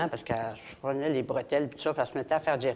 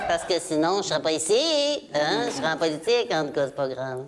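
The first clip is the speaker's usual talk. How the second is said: nasal politician caricature, amused; mock-modest retired politician impression; Pauline Marois. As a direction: chatter through the nose with little laughs. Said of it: "Et ça, c'est ma Pauline Marois".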